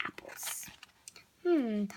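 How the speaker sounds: soft whispered narration; whispering the story to a child. A narrator's reading. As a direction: hush the line like a secret.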